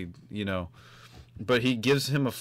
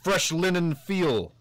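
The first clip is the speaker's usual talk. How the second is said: slow, higher put-on celebrity drawl; Christopher Walken impression; 7 semitones higher, slower than usual. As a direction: drag each word out like a quirky film star. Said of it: "Everyone's gonna do a Christopher Walken impression".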